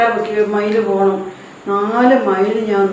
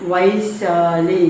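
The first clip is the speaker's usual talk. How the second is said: Vaishali drawn out very slowly, syllable by syllable; slow spelling-out; 2 semitones lower, much slower than usual. Shown as a demonstration. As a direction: sound out Vaishali for young pupils. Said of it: "ഒരു രാജാവിൻ്റെ മകളുടെ പേരാണ്". (വൈശാലി പതുക്കെ നീട്ടി ഉച്ചരിക്കുക)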